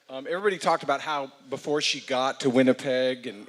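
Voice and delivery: normal talking voice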